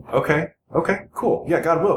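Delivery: mock eager agreement. Mocking his younger self agreeing to give his paycheck, eager. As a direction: chirp it like you bought it completely.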